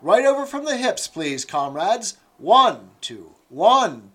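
loud, high counting of exercise reps; drill calling. Acted. Instructress counting the exercise for comrades, loud drill call. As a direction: bark the count at the squad.

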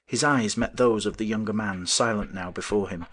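ordinary delivery, nothing marked

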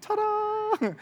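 high, bright showman's "Ta-da!"; God's ta-da. Retold or imitated. Pastor voicing God revealing the answer, playful high ta-da. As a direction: announce it like a magician's reveal. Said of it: "God's like".

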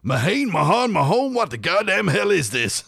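teacher taking the register, mock outrage at an Irish surname; American teacher baffled. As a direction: mangle the name, then blow up in exasperation. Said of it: "then he went".

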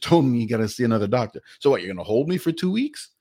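ordinary talk, nothing marked

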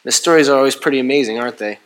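ordinary talk

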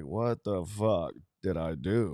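lightly voiced stunned father; imitating dad. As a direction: drag it out like a baffled father.

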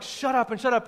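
high-pitched repeated shushing; mock shouting-down; acted angry crowd. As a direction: nag him into silence. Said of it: "The more they were like".

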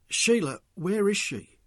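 rising agitation, pitch well up. A narrator's reading. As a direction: press urgently for an answer. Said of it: "Mick had become more agitated".

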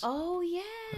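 high, drawn-out oh of realization. Real, not acted. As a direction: let it dawn on you slowly. Dawning realization about Darwin.

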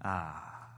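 very soft, low contented "ah"; imitated sigh as the pain passes; relieved sigh. Wife smiling once the screaming ends, relieved sigh. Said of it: "And then she smiled".

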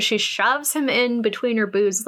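normal talking voice

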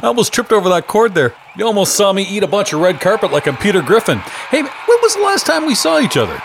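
excited red carpet greeting. Acted. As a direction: call out like a thrilled celebrity. Host arriving, calling out excitedly.

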